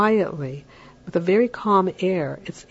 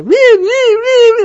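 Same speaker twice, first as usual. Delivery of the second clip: put-on shrill high squeals of fighting kids, much louder; imitated kids screaming; 13 dB louder than usual. Parent mimics children screaming in a fight, high loud squeals. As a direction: squeal like kids tearing at each other. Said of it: "they're screaming and they're yelling".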